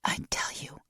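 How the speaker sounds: soft unvoiced whisper. A narrator's reading. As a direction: breathe it into his ear. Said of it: "she whispered back".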